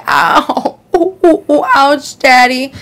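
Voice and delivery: high, breathy-free mock moaning ouches; hammed-up porn moan. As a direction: overplay a cooing "ooh, ouch, daddy". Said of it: "I made her say".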